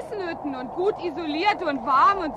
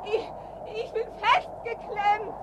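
high, strained pleading; higher desperate plea; trapped and pleading; 6 semitones higher than usual. Acted. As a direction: beg for help while pinned down.

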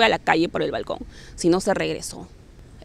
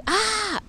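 grito imitado; 13 semitones higher than usual. Retold or imitated. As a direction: mimic a drawn-out high shriek. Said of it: "como hicieron un grito de". (grito agudo imitado, sin mucho volumen)

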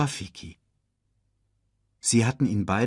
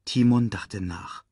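ordinary delivery

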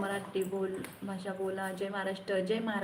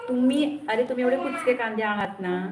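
loud, heated jab at the commenters; angry outburst; 2 semitones higher, 6 dB louder than usual. Real, not acted. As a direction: shout them down. Insulting rotten-onion commenters. (रागाने ओरडून)

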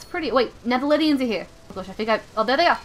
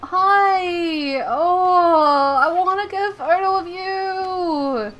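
mock whimper; 6 semitones higher, much slower than usual. Whimpering plea to Raichu.

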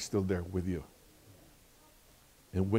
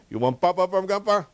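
high, loud grandpa warning; warning threat; 9 semitones higher, 6 dB louder than usual. Retold or imitated. Grandpa warns toddler of a smack, threatening. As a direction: raise pitch and volume in a playful retold threat.

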